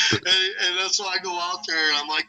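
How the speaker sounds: ordinary delivery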